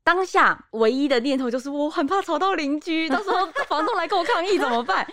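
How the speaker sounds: laughing through the words, pitch up. Real, not acted. Recalling her fear of bothering the neighbours, laughing. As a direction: giggle at how silly the worry was.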